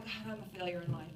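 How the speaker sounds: mock self-pity. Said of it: "you're like".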